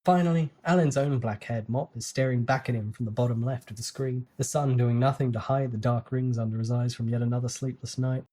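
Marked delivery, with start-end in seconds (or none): none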